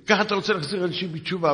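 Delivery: high-pitched mock scolding over the phone; indignant telling-off, acted out; retold angry yelling. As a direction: imitate an outraged caller, pitched well up. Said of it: "והתחיל לצעוק עליי בטלפון".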